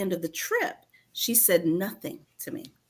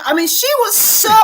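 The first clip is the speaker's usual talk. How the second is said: usual speaking voice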